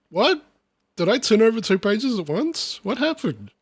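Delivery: high-pitched, bewildered questioning; baffled disbelief. Real, not acted. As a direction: react as if you lost the plot. Lost by the poem's ending.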